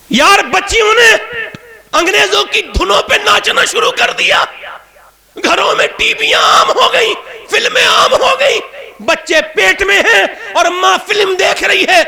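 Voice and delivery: fiery preacher's lament; full-force preaching. Acted. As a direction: preach it out as if pleading with the crowd.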